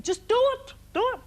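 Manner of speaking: high, loud, insistent command; retold impatient outburst; exasperated order. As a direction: imitate an agent pushing hard, voice jumping up. Retold agent giving an exasperated order.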